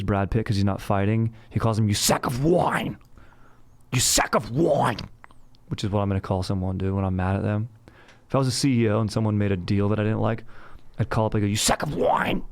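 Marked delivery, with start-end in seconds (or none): 1.9-5.0 strained, drawn-out insult from an enraged Greek king; hammed-up theatrical outrage; psycho movie rant. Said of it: "sounded like a psycho when he said it". 11.5-12.4 mock-enraged CEO phone call; hammed-up strained outburst; psycho boss. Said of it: "sounded like a psycho when he said it".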